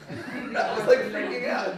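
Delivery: real laugh breaking in. Real, not acted. Rabbi laughing while telling the class his dream.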